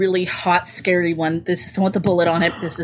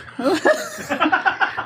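high laugh; 10 semitones higher than usual. Real, not acted. Laughing at the Batman joke.

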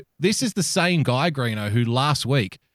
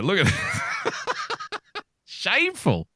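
laughing disbelief; 4 dB quieter, much slower than usual. Real, not acted. Laughing at the cartoon.

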